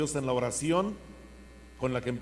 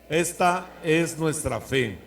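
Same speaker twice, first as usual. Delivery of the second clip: slow, louder joyful response; joyful proclamation; 4 semitones higher, 7 dB louder, slower than usual. Acted. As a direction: proclaim it with joy. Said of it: "Y decimos con alegría".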